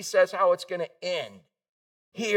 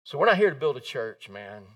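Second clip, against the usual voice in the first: ordinary talk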